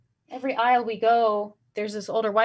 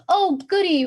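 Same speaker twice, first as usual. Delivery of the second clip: mock glee; 9 semitones higher, 5 dB louder than usual. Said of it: "she was like".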